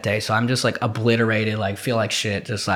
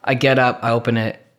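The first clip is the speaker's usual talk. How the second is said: ordinary talk, nothing marked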